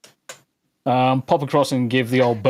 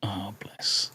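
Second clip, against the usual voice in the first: whispered; 5 semitones lower, 10 dB quieter than usual. Real, not acted. Cooing at cute image.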